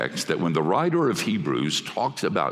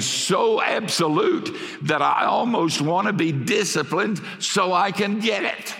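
rising preaching shout; 10 semitones higher than usual. Real, not acted. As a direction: swell up with joy at the hug. Preacher climax on God's hug.

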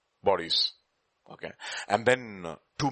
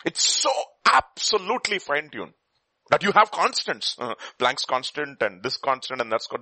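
preaching; 5 semitones higher than usual. Acted. Preaching about the universe's constants.

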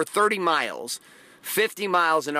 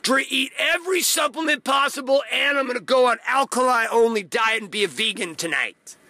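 overeager mock hype; 5 semitones higher than usual. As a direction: play the overexcited newbie promising too much. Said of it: "they're like".

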